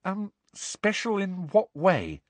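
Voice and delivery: slow, high, hesitant question; cautious high-pitched query; tentative. A narrator's reading. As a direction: ask warily, feeling your way. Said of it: "asked Hermione tentatively".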